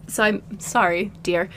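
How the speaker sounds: normal talking voice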